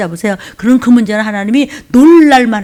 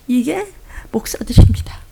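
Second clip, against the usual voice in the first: whispered aside. Real, not acted. Preacher confiding to congregation, whispered aside. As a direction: drop to a whisper on the punchline. Pointed aside about pastors.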